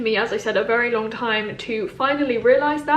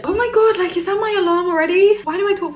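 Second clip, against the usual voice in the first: high, louder burst of surprise; startled exclamation; 8 semitones higher, 4 dB louder than usual. Real, not acted. Vlogger interrupted by her own alarm, startled. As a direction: exclaim high, caught off guard.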